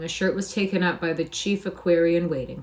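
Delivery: usual speaking voice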